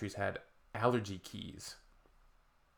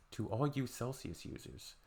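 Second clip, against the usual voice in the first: much softer, muttered repeat; quiet repeat; 9 dB quieter than usual. Real, not acted. Addressing Celsius users, quiet repeat.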